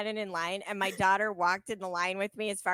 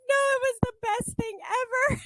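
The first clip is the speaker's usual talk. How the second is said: teary joy; 4 dB louder than usual. Retold or imitated. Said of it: "I'm like".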